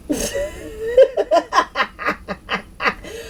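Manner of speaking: high, crying laugh; laughing to tears. Real, not acted. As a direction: laugh until you almost cry. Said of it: "so fucking funny to me".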